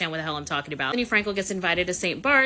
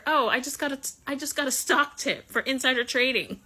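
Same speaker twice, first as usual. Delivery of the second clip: higher, breezy mocking comeback; mock Bethany comeback; 7 semitones higher than usual. Bethany firing back at Martha in the booth, higher mock comeback. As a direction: toss it back lightly, pitched higher. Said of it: "Bethany says back to her".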